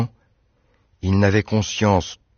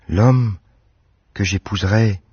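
dreamy smile; 2 semitones higher than usual. A narrator's reading. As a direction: smile and dream the words "L'homme que j'épouserais". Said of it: "sourire étrange, plein de rêves".

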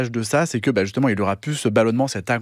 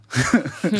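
laugh; 5 semitones higher than usual. Real, not acted. Guest laughs at own joke.

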